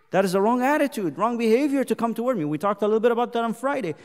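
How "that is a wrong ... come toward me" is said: loud, high preaching; forceful, emphatic pulpit proclamation; very serious warning. Acted. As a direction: preach it as God's stern warning. Preaches God's rebuke.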